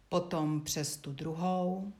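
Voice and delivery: usual speaking voice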